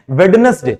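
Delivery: वेडनेसडे as "wed-nes-day", D sounded; spell-it-out reading of वेडनेसडे with the D; children's misreading. Retold or imitated. Teacher quotes small children's mistake, "wed-nes-day" for वेडनेसडे. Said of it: "छोटे बच्चे अक्सर इसको pronounce करते हैं".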